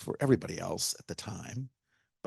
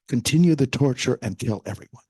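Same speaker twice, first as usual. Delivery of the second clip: loud, commanding tyrant's order; 2 semitones higher, 9 dB louder than usual. Retold or imitated. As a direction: bark the order to keep torturing. Tyrant orders more torture.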